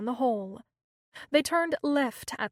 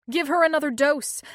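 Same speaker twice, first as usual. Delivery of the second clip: demanding; 4 semitones higher, 7 dB louder than usual. A narrator's reading. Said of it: "demanded Angela".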